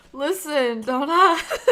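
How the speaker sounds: loud laughing protest. Real, not acted. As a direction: laugh through the words. Laughing embarrassed.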